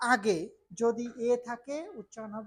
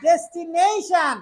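slow, loud, stretched call; loud drill; 5 semitones higher, 11 dB louder, slower than usual. Shown as a demonstration. Teacher calling words slowly and loudly for the class to repeat. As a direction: belt out each word slowly. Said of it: "Speak loudly with me।".

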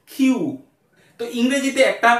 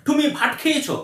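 higher-pitched imitation of a foreigner's Bengali; foreigner's accented Bengali; 7 semitones higher than usual. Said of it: "এরকম করেই বলবে".

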